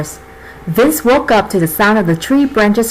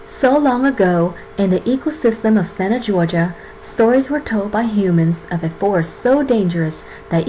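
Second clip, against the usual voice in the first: epic fantasy narrator; 4 semitones lower, 4 dB quieter than usual. Acted. Narrates fairy-tale opening.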